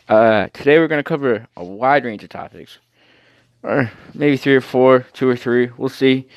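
ordinary talk

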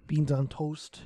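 breathy hushed aside; whisper. Real, not acted. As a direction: whisper it like a secret treat. Whispered aside about beans.

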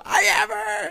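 mock high-pitched scream into a pillow; hammed-up wail, high and loud. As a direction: pretend to scream your hurt into a pillow. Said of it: "You'd say that in your pillow".

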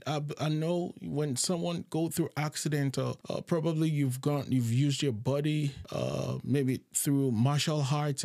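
ordinary talk, nothing marked

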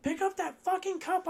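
high, loud mocking voice of the lady; hammed-up screech as the angry Karen; loud mock Karen squeal; mocking shrill Karen. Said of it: "She's like".

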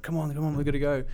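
high, urgent mock yell; retold yell. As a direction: hurry them along like a panicked cabbie. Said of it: "taxi driver's yelling at us".

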